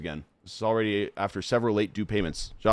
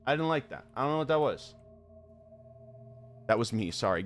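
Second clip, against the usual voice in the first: ordinary delivery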